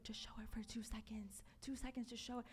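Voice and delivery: hushed, breathy push to go ahead; urgent whisper. Retold or imitated. Friend sitting beside her, urging her on during the call, whispered. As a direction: whisper it so the guy on the call can't hear.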